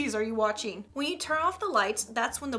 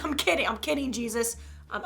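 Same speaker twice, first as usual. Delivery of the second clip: ordinary delivery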